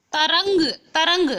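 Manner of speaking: तरंग said high and loud, न sound clear; half n sound shown. Shown as a demonstration. Teacher shows students the half न in तरंग. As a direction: punch तरंग so the nasal shows. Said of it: "आधे न की आवाज़ आ रही है ना".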